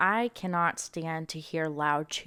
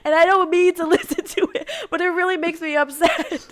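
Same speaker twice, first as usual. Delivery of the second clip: high laughing protest; 10 semitones higher, 10 dB louder than usual. Real, not acted. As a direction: laugh through the words. Laughing at disgust.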